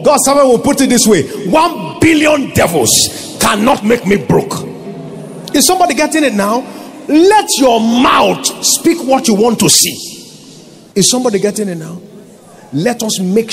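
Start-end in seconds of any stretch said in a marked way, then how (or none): 0.0-10.0 preaching at full force. Acted. Pastor rousing the congregation, full-force preaching. Preaching climax on thinking and speaking.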